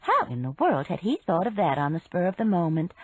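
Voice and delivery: ordinary delivery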